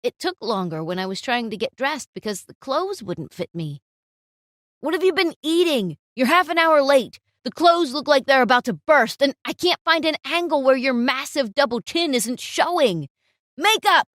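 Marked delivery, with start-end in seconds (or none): 4.8-14.1 loud, cutting rant at a late model; harsh scolding, louder as it goes; angry photographer. Acted. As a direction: berate her like a furious boss. Frustrated photographer berates model.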